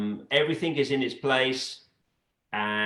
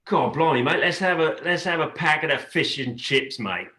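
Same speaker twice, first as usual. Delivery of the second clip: British accent; 2 semitones higher than usual. Shown as a demonstration. Contestant asked for the most British thing, in a British accent. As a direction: put on a matey British accent. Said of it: "in a British accent".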